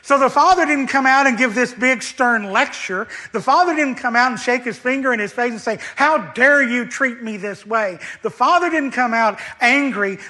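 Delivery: impassioned sermon voice; preaching at full force. Acted. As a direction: drive the point home to the congregation.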